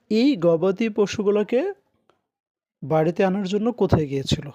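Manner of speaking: ordinary talk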